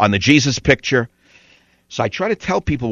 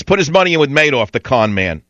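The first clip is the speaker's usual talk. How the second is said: normal talking voice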